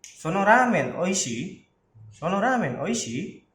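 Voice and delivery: slow Sono ramen oishi? with the question carried by the tune; slow teaching demo of a casual question. Shown as a demonstration. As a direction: ask Sono ramen oishi? using only intonation. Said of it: "Jadi pakainya nada".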